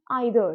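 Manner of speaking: slow American "either", EYE-ther; teacher's model of "either" starting like "eye"; American pronunciation. Shown as a demonstration. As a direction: say "either" slowly with "eye" first. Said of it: "American accent में बोलूँ".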